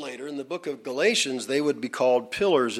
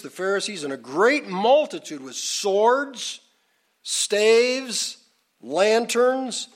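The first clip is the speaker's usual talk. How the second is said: high, projected list-building delivery; preaching narration; 7 semitones higher than usual. Real, not acted. Preacher describing Judas arriving with a multitude, raised narrating voice. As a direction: announce each item separately in a raised pulpit voice.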